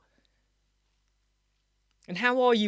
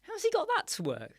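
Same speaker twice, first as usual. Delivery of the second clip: higher, wondering aloud how he did it; replaying his own puzzled thought, pitched up; surprised inner thought; 10 semitones higher, 4 dB quieter than usual. Host recalls wondering about Mark's trick, surprised and higher. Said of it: "I thought".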